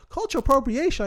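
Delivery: high-pitched questioning repeat; incredulous echo. Retold or imitated. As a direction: throw it back as a disbelieving question. Echoes the complaint in disbelief.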